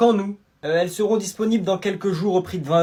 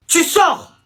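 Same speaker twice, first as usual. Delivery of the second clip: high, raised teacher's shout; sharp, high-pitched dismissal; angry order; 7 semitones higher, 4 dB louder than usual. Acted. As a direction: throw the pupil out with a yell. Teacher throws pupil out.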